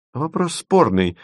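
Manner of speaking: high, drawn-out holding forth; pontificating. A narrator's reading. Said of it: "начал было разглагольствовать Леденец".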